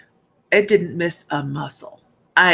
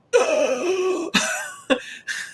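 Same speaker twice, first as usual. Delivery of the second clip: sharp gasp; 11 semitones higher, 3 dB quieter than usual. Retold or imitated. Cramp strikes like lightning.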